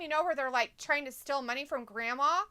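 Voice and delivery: usual speaking voice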